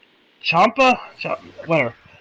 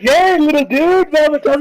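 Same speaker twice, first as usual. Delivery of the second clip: excited greeting; 10 semitones higher, 4 dB louder than usual. Retold or imitated. Goku greets the little Grand Leader, imitated high excitement. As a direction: imitate an over-friendly, high-pitched greeting.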